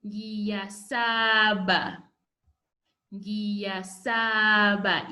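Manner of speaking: "ngiyasaba" drawn out slowly, every syllable clear; slow model word. Shown as a demonstration. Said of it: "you say".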